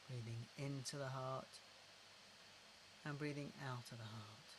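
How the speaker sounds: unhurried, gentle repetition into the heart; guided breathing. Shown as a demonstration.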